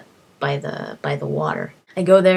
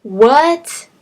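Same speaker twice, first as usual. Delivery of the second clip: higher, louder rising what; shocked what; 7 semitones higher, 7 dB louder than usual. Retold or imitated. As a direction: disbelief at being across the map. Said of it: "I'm just like".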